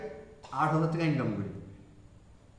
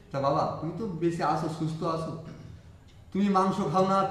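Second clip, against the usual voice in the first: normal talking voice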